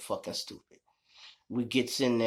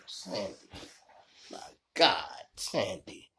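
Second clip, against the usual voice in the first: dismayed groan; 5 semitones lower, 5 dB quieter, slower than usual. Retold or imitated. Husband dismayed by wife's answer.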